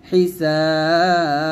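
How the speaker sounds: slow recited হিসাব with a long madd and an echoing bounce on the final ব; qalqala on ba. Shown as a demonstration. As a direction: chant হিসাব, hold the vowel, then bounce the ব. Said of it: "but কলকলা করব". (হিসাব শব্দে টান দিয়ে শেষের ব-তে কলকলা)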